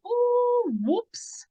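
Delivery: high, sheepish oh whoops; caught-out oops. Retold or imitated. Mum catching herself after shouting, sheepish high whoops. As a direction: pop up high like you've been caught out. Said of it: "you go".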